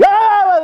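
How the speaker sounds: high-pitched imitated holler; loud mock yell. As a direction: suddenly blurt out a high, loud "Ah!". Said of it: "He'd start talking really loud".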